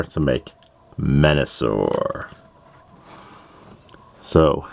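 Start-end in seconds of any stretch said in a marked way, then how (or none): 1.0-2.3 low, slow, drawn-out name; dramatic name reveal. Pretend. Host announcing what the change will make, low drawn-out flourish. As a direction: stretch the name out low for effect.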